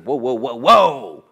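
loud rising whoa whoa, building to a shout; Father's excited call. Acted. The Father speaking from heaven, loud excited whoa. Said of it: "this voice comes down from the Father saying".

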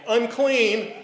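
high, loud cry of unclean; leper's loud warning cry; shouted warning. Retold or imitated. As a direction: call it out as you push through a crowd. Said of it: "walked through the crowd saying".

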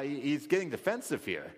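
ordinary delivery

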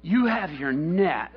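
slow, deliberate, spaced-out phrases; patient correcting. Acted. Jesus telling Peter what's wrong, slowly and deliberately. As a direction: lay it out very slowly, piece by piece.

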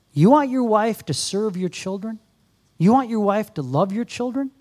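usual speaking voice

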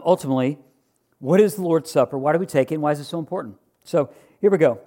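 ordinary talk

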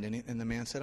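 ordinary talk, nothing marked

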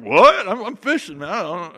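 a loud startled "What?" from a rough fisherman; crude fisherman. Acted. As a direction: play a baffled, rough working man, lightly voiced. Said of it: "he's this crude fisherman".

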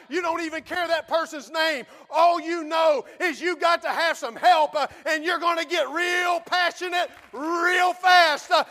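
loud, high, shouting sermon; fiery preaching. Acted. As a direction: preach it with full force. Preaching at full force.

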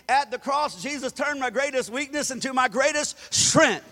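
loud, ringing preacher's shout; shouted finale. Acted. Pastor closing the sermon to the congregation, loud shout. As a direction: crown the sermon with a cry.

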